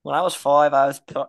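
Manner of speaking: put-on British accent. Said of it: "we have to talk in a British accent".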